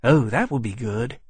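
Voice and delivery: a touch of pleased eagerness; brightening. A narrator's reading. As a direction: brighten up mildly at the offer.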